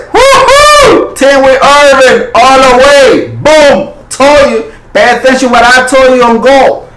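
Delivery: loud, high victory whoop and gloat; real overjoyed shouting; excited cheering. Real, not acted. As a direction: celebrate the call you got right. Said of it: "I get so excited".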